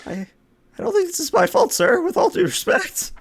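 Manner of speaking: high squeaky nervous ensign. Acted. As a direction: squeak it out, flustered. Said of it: "I love the squeaky voice".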